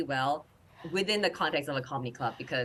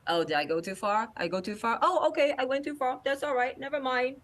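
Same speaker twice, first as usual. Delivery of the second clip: high mock-innocent comedian tease; teasing stage voice; 9 semitones higher, 3 dB louder than usual. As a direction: pretend you didn't mean it.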